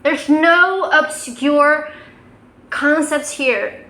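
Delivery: ordinary talk, nothing marked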